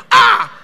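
very high, very loud gasping cry; mock amazed scream. Church member hearing wife's name, mock amazed scream. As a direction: shriek in hammed-up amazement.

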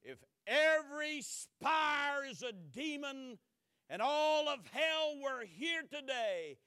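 slow, loud, high declaration; thundered. Retold or imitated. Luther defies the court, thundering slowly. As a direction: thunder it out for all to hear. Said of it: "he thundered".

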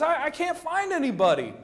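a prophet losing patience, voiced by the preacher; frustrated Samuel. Preacher voicing Samuel pleading with Jesse, mildly exasperated. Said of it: "I'm sure Samuel's frustrated".

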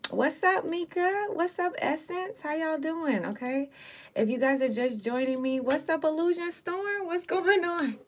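high, bright livestream hello; excited greetings. Real, not acted. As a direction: greet each viewer with big energy. Greeting live viewers.

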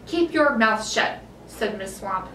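ordinary delivery, nothing marked

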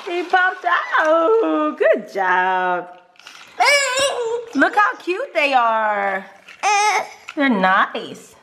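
high, loud, drawn-out praise; delighted cooing. Real, not acted. Mother praising and admiring her little one, excited cooing. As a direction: gush slowly over a little one.

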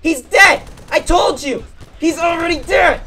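high, loud told-you-so yell; triumphant shout. Real, not acted. Gamer to viewers about his teammate, triumphant shouting. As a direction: crow it out loud.

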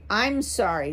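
plain reading voice

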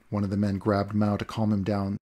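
plain reading voice

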